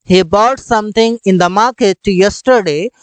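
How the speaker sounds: very slow, every word separate; slow clear English. Shown as a demonstration. Teacher modelling the English sentence slowly. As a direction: spell the sentence out for learners. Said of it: "मतलब वो कल कुछ बाजार में खरीदा।".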